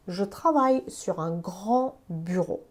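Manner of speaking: Je travaille sur un grand bureau, read slowly word by word; slow French example. Shown as a demonstration. Teacher reads the French sentence slowly for learners. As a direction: spell it out slowly for the class. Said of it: "جمله رو ببین".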